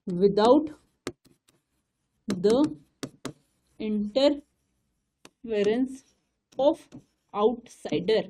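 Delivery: very slow, "Without the interference of outsider" broken into single words; word by word dictation. Shown as a demonstration. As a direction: dictate each word for notes. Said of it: "dictating word by word". (शब्द शब्द रुककर लिखवाना)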